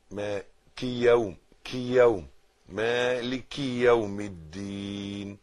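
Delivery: ordinary delivery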